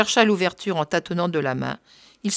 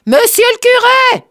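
full shout; 11 dB louder than usual. A narrator's reading. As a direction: yell for the priest over the sizzle of frying. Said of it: "La friture empêchait. Il cria".

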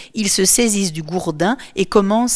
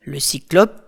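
ordinary delivery, nothing marked

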